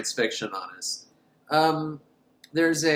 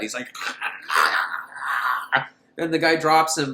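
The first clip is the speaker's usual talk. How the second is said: usual speaking voice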